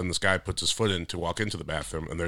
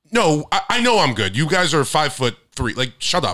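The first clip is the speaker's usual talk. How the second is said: loud, higher mock confrontation; snapping back at the guys, louder; retold telling off; 7 semitones higher, 5 dB louder than usual. To the rude kids at the bathroom, a loud mock telling off.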